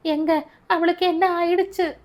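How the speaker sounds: high, crying plea for her daughter; panicked mother's wail; wailing in distress. Acted. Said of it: "கேட்டு கதறினாள் அவள்".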